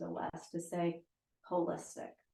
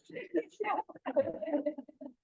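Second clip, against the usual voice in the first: real laugh; 9 semitones higher, 3 dB louder than usual. Real, not acted. Laughs at the dog's interruption.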